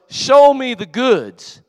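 slow, loud, high drawn-out demand; emphatic preaching shout. Retold or imitated. Preacher explains what Rod was saying, slow emphatic shout. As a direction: proclaim it slowly at full volume.

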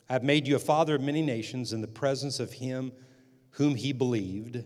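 loud, declaiming reading of the verse; emphatic scripture reading. Acted. Pastor reads Romans aloud to the congregation, declaiming. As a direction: proclaim the text to the room.

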